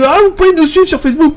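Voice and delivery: bright high imitation of the owner; high mock patronne voice. Imitating the café owner asking about Facebook, high-pitched. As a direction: pitch it way up, playful. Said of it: "Elle me dit".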